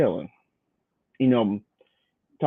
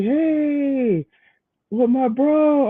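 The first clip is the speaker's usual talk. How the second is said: higher, chummy mock greeting; imitating Diamond; 12 semitones higher, much slower than usual. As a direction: play Diamond cheerfully clueless, pitched up. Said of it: "He's like".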